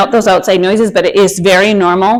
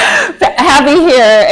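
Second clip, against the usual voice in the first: laughing; 8 semitones higher, 4 dB louder, much slower than usual. Real, not acted. Laughing while saying she's happy.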